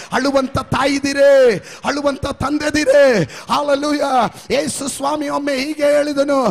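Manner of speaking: fervent pulpit appeal; preaching at full force. Acted. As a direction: preach it out to the whole congregation. Preacher exhorting parents.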